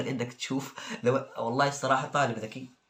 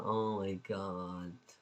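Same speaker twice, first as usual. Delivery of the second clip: long, drawn-out, deflated oh my god; exasperated sigh; 6 semitones lower, 8 dB quieter, much slower than usual. Real, not acted. Reading a student's nonsense answer, drawn-out despair. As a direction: give up in disbelief.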